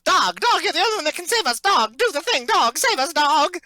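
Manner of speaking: high, loud, desperate cry to the dog; mock pleading. Joking about the show, begging the dog character in a high, loud voice. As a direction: beg the dog to save everyone.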